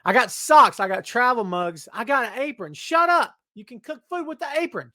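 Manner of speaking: too much caffeine, hyped and loud; bouncing off the walls; wired on coffee. Real, not acted. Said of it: "I'm wired right now".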